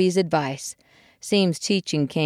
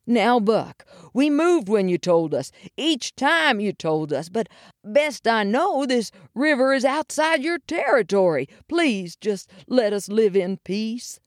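higher-pitched, placating appeal; pleading muskrat; 6 semitones higher than usual. A narrator's reading. Muskrat dad asking the bear for peace, higher pleading voice. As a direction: reason with a bully, imploring him. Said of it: "tried his best".

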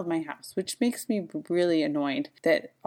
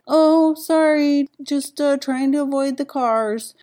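higher, louder, slow flustered apology from the man; startled stranger; 7 semitones higher, 6 dB louder than usual. Retold or imitated. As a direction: play the caught-off-guard man, fumbling an excuse. Man apologizes after being yelled at.

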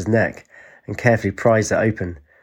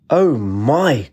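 surprised; 4 semitones higher, 4 dB louder than usual. Acted. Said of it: "cried Jane".

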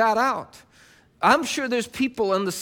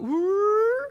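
high, rising swerve noise; drawn-out mock detour sound; playful "ooh"; 11 semitones higher than usual. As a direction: slide a comic "ooh" upward. Imitates a small detour.

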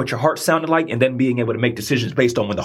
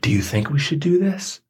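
heart whispering. Retold or imitated. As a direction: barely voice it, gently asking. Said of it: "It's gonna whisper".

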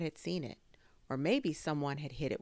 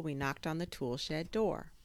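ordinary delivery, nothing marked

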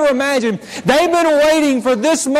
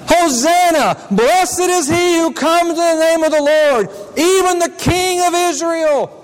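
joyful acclaim; 4 semitones higher than usual. Acted. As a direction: hail the coming king with joy. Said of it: "it's a shout of joy".

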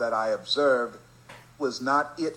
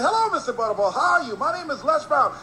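much higher, louder, bursting with cheer; over-eager greeting; 13 semitones higher, 5 dB louder than usual. Retold or imitated. Les greets the disc jockey again, high and loud with cheer. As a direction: greet him like it's the first time ever. Fourth visit, bright greeting.

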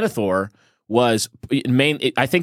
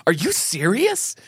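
high, drawn-out mock incredulity; slow, high scoffing question; Tucker Carlson; 10 semitones higher than usual. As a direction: imitate laughing disbelief, pitched way up. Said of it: "he was laughing in his face".

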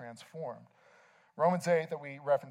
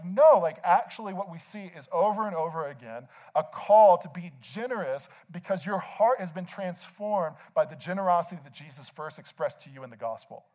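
ordinary talk, nothing marked

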